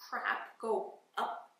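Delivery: drawn out slowly for effect; slow emphasis. Real, not acted. To viewers about pushing, slow emphatic words. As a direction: stretch each word. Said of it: "slow emphasis".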